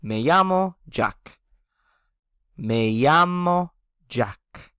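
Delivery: "Me llamo Jack" very slowly, llamo as YA-mo; slow teacher model of "Me llamo Jack"; slow Spanish model. Shown as a demonstration. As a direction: spell it out with the voice, slowly. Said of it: "And I'm gonna say".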